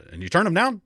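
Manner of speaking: a boss who can't believe it; surprised question. Retold or imitated. Pastor quizzing his youth leader, surprised, high and loud. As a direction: pitch jumps up high and loud in disbelief.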